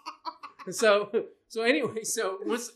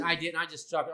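usual speaking voice

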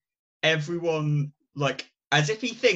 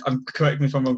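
ordinary talk

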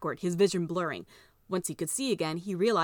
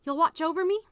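little sister voice; 11 semitones higher, 5 dB louder than usual. Acted. Little sister asks brother.

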